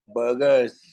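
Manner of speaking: low, twisted-mouth English बर्गर्स; affected English accent. Shown as a demonstration. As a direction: put on a fancy English accent. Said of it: "थोड़ा मुंह टेढ़ा करके बोलना पड़ेगा".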